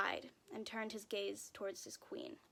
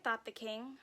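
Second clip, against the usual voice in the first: usual speaking voice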